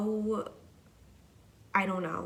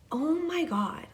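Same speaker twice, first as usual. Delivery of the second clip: high, bright gasp of disbelief, a little louder; delighted disbelief; 9 semitones higher, 4 dB louder than usual. Retold or imitated. Touched by a stranger's kindness, exclaiming in delight. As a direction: let it jump up in amazement.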